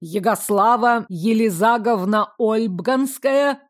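lawyer with a guttural burred r, every r in «Ягослава Елизаговна Ольбганская» rolled in the throat. A narrator's reading. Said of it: "смешным картавым говором".

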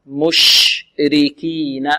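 very slow مشركين with a long hissing sh; spreading SH. Shown as a demonstration. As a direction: draw out each syllable of مشركين, sh spread wide. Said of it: "نعطي الشين تفشيها".

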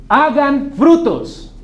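mandato de profeta, fuerte, agudo y muy lento; Juan. Acted. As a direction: ordena palabra por palabra, alargándolas. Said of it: "Juan dice". (proclamación imperiosa y espaciada)

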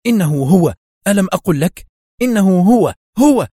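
loud, high agitated shout; excited cry. A narrator's reading. Sonia spots Adham through binoculars, loud agitated cry. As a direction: cry out as the target finally appears. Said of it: "تهتف بصوت متحشرج".

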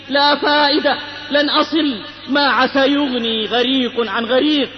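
ordinary talk

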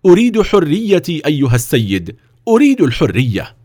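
high, heartfelt demand; impassioned cry for freedom; fervent plea. A narrator's reading. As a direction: declare it with heat. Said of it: "وقال في حرارة".

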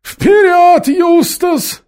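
very high, loud rallying yell; rally your comrade with a shout; shouted command. A narrator's reading. Khmur sending Eustace forward, shouted command. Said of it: "скомандовал Хмур".

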